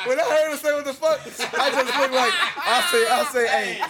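nearly losing it laughing. Real, not acted. Cracking up at the memory.

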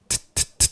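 トゥットゥットゥッ as short crisp English T bursts; isolated T sound. Shown as a demonstration. Teacher isolating the first sound, crisp T demo. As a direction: tap out a bare T three times, not "tsu". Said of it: "つじゃなくてTは".

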